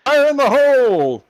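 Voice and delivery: put-on high, loud drunken yell; drunk bear shout. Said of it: "Wojtek with the oranges hammered as shit going".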